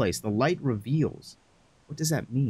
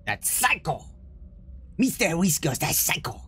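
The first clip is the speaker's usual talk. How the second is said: echoing the whisper; 2 semitones higher than usual. Retold or imitated. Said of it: "That's a psycho".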